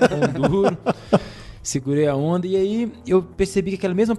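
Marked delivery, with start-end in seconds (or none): 0.0-0.8 laughing. Real, not acted. Laughs at own stinginess.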